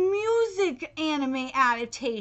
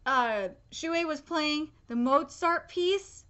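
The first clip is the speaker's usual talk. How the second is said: normal talking voice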